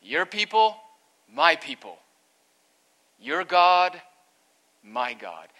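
each phrase punched loud, with a pause between; verbless, emphatic reading. Shown as a demonstration. Preacher reading the verse to the congregation, loud and emphatic. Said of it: "the third sentence should read like this".

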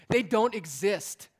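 loud, high and slow declaration; rising shouted proclamation; preaching shout. Acted. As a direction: thunder it out from the pulpit.

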